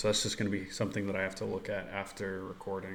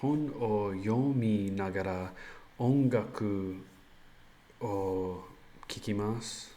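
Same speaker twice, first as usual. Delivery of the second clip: slow halting Japanese; slower than usual. Acted. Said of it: "Like I say, I listen to music".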